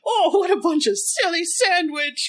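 high, loud, hammed-up British sketch voice; British accent. Landlady dismissing the visitor's song, in an exaggerated British accent. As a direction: scoff in an over-the-top old-time British accent. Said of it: "try to do the accent".

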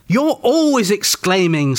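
high, drawn-out squabbling accusation; accusing. A narrator's reading. Vera squabbles with Peter over exclaiming, accusing.

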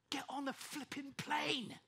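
blurting it out at Dad in desperation; retold outburst. Pleading loudly with her dad to fly home. Everyone went quiet.

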